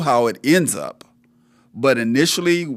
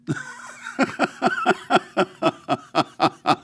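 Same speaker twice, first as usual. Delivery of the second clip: hearty laugh; 9 semitones higher than usual. Real, not acted. Host laughs before explaining.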